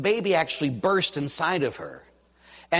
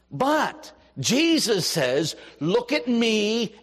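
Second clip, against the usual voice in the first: slow emphatic plea; 6 semitones higher, much slower than usual. Real, not acted. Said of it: "slowed emphatic preaching".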